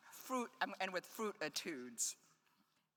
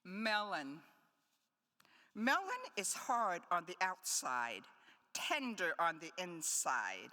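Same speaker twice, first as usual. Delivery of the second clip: poem reading; 2 semitones higher than usual. Acted. Poet to audience, reading the fruit etudes. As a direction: give each fruit name its own weight.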